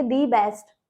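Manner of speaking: long ee in "the best"; "the" as dee. Shown as a demonstration. Teacher showing how we will say it, "the" as "dee". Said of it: "ऐसे बोलेंगे".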